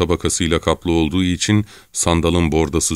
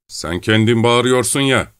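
raised, higher-pitched retort; calling back; 7 semitones higher than usual. Acted. Çelkaş answering the distant challenger, calling back. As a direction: call back across the water.

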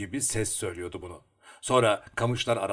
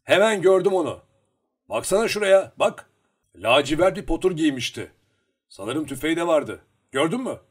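loud, high, urgent storytelling voice; raised voice, pressing the listener; excited eyewitness character; 6 semitones higher, 9 dB louder than usual. A narrator's reading. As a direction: report the sighting eagerly, pitched up and loud.

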